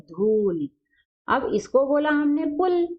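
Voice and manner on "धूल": धूल stretched slowly; slow teacher's read of धूल; slowly sounded out. Shown as a demonstration. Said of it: "sounding out the word".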